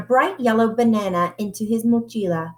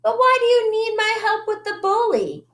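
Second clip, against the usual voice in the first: high puzzled kitty voice; 12 semitones higher, faster than usual. Acted. Skipito voiced as a character.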